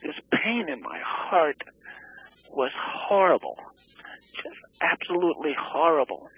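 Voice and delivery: reliving the pain, voice cracking; voice breaking with grief. Real, not acted. Telling the interviewer about his grief, voice cracking high.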